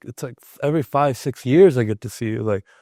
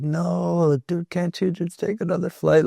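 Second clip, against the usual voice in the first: pleading, crushed; 6 semitones higher than usual. Retold or imitated. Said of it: "being so crushed".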